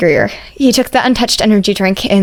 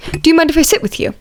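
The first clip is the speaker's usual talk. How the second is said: higher, courteous voice; polite classmate; 8 semitones higher than usual. Acted. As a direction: ask politely to join the table. Ida asks politely.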